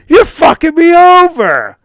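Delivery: very high, loud yelled accusation; imitated furious yell. Friend to the crooked referee player, imitated outraged yell. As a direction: yell it as the cheated friend, voice shooting up.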